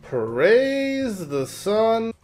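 high, slow, drawn-out cry; drawn-out exultation. Pretend. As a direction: hail it, stretching every word. Mock-heroic game cry, drawn out.

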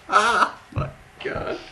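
high, loud laugh into words; helpless laughter. Real, not acted. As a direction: gasp it out while cracking up. Overcome by laughter at the answer.

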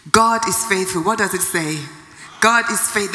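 ordinary talk, nothing marked

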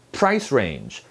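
PRICE strong, "range" weaker and falling; "Price range" with the weight up front; stress on PRICE. Shown as a demonstration. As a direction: lean on "Price", let "range" drop. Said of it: "range보다 price에 강세가 더".

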